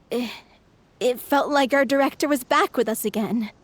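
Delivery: normal talking voice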